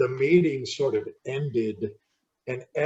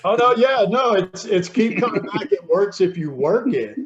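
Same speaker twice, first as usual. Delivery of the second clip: eager correction; 6 semitones higher, 5 dB louder than usual. Shown as a demonstration. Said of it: "you gotta say the rest of it".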